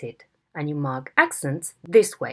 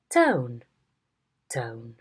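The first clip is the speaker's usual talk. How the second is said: the word "tone" said seven times, each with a different tone; "tone" with each tone in turn; tone demos; 3 semitones higher, much slower than usual. Shown as a demonstration. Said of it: "there you have all the different tones with the word tone".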